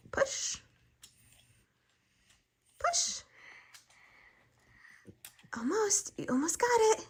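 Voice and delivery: high breathy whisper urging her on; whispered encouragement. Real, not acted. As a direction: whisper it like a secret cheer.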